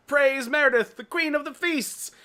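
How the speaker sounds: high, loud cry of praise; mock proclamation. Blackwood hailing the queen of the feasts, proclaiming. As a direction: hail her like a cult leader.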